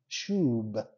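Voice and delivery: ordinary talk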